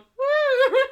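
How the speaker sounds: put-on high, loud childish whoop; hammed-up squealing child; excited six-year-old. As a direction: whoop like a little kid. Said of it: "like a little six-year-old going".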